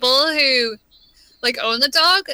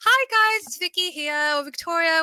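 high, lifted YouTuber intro; YouTube voice; 5 semitones higher than usual. Shown as a demonstration. Showing her YouTube intro, high presenter voice. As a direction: open a video, pitched up. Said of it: "And YouTube, like".

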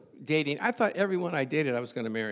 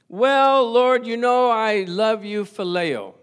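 loud, high, slow hedging reply; Peter answering loudly; 6 semitones higher, 7 dB louder, slower than usual. Retold or imitated. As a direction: drag it out, half-hearted love. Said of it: "And Peter answered".

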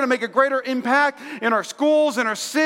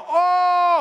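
pained groan; 7 semitones higher, 3 dB louder than usual. Retold or imitated. Back flares up lifting couch.

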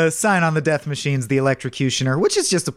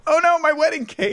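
high mock wail; bystander cry. Guest losing wedding cake, high wail. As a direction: squeal in fake despair. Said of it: "you hear someone go".

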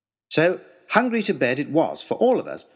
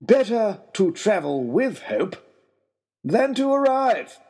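slow and much higher, savouring the punchline; his lordship's joke; 8 semitones higher, slower than usual. Retold or imitated. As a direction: deliver the joke like a smug lord, drawn out. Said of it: "This is his lordship's joke".

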